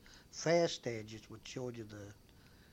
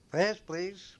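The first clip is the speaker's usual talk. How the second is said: louder, higher call of a bus conductor; 6 semitones higher, 7 dB louder than usual. Retold or imitated. Conductor calling out fares.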